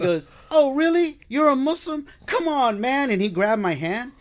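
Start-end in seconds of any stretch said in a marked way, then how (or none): 0.5-3.1 high, loud, thrilled welcome; delighted inmate. Retold or imitated. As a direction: greet him like a long-lost brother.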